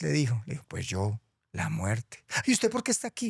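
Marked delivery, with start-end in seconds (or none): 0.7-2.2 a hint of a low, hushed Death; lightly voiced Death. La muerte responde. 2.5-3.3 high, startled little duck; squeaky duck voice. Acted. Pato pregunta otra vez.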